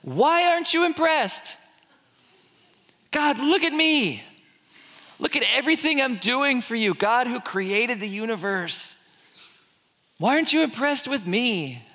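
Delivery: mock self-righteous whine; whiny demand. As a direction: beg for God's applause. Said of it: "they ask this question".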